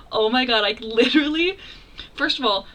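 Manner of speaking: normal talking voice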